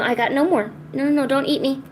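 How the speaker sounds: ordinary delivery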